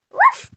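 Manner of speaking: high yappy bark sound; imitated dog bark. Woman imitating her dog's sudden high bark. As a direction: yip it out like a small dog. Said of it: "and she went".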